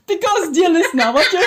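high, giggly aside; laughing explanation. Real, not acted. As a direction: address the audience through real laughter. Laughing while explaining to listeners.

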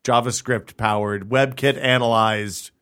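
slow, drawn-out tech jargon; slow buzzword list. Acted. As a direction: savor each buzzword slowly. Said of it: "I'm just saying words that I've heard".

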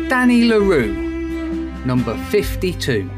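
sing-song bingo number calls; bingo caller. Acted. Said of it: "eyes down, here's the answer".

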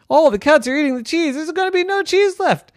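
very high, loud, fast squeaky alarm; panicked mice. Acted. Mice panic that the cats are eating all the cheese, high and fast. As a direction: rush it out in panic.

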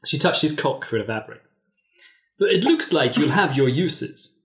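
steady storytelling read; reading a racy story aloud to friends; story narration. Acted. Reads erotic story aloud.

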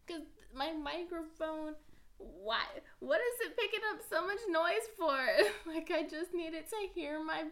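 loud, high cry of frustration; exasperated outburst. Real, not acted. Podcaster venting at her microphone for picking up noise. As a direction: throw the question at the microphone.

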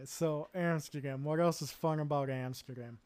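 ordinary talk, nothing marked

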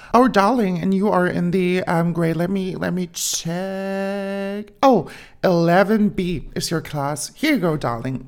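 lightly voiced friendly secretary, drawn out; sweet secretary. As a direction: coo darling like a kind secretary. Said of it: "sagt sie so". (leicht gespielte, freundliche Sekretärin, gedehnt)

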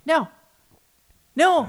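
loud high-pitched No; emphatic no. Real, not acted. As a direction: shout the no in disbelief. Emphatic denial.

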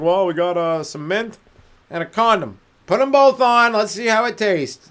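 rising to a loud, high "Put 'em both on"; excited inventor. Acted. Inventor proposing mint and condom.

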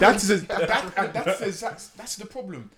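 laughing. Real, not acted. Laughing while making a point.

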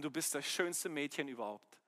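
soft, low fatherly murmur; tender endearment. Retold or imitated. Father retelling his daily praise to his daughter, tender endearment. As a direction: say it gently, just for her.

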